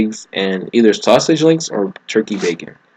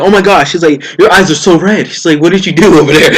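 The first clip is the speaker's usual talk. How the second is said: high, loud alarmed mom voice; panicked mother, voice way up; mom freaking out; 7 semitones higher, 5 dB louder than usual. Retold or imitated. Mom alarmed at son's red eyes: high, loud panic.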